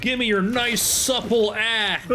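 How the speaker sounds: high, drawn-out hammed-up cry; mock demand. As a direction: draw out the last words in a mock war cry. Mock war cry while looting body parts.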